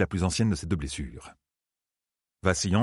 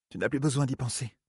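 soft, higher-pitched reassurance; inquiétude tendre; 6 semitones higher, 4 dB quieter than usual. A narrator's reading. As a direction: gently soothe him with a touch of worry. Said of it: "L'inquiétude visible sur son visage". (rassurer doucement, voix plus aiguë)

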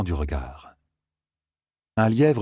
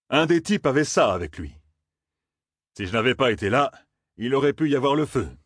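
a lightly voiced, higher, dry old gleeman; slightly higher, wry and knowing; Tom's voice; 5 semitones higher than usual. A narrator's reading. Gleeman Tom to young Matt, lightly voiced and wry.

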